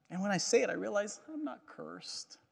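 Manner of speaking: usual speaking voice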